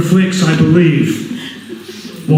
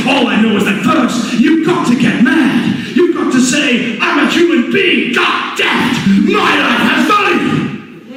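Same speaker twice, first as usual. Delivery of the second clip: rising to a high yell; impassioned rally cry; building to a shout; 12 semitones higher than usual. Acted. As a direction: whip the crowd up until you're yelling. Rally speech building to yelling.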